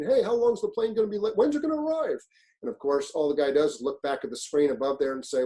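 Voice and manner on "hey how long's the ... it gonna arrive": much higher, nagging impatient traveler; whiny passenger. Retold or imitated. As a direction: pester the gate agent like an annoying passenger. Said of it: "basically saying".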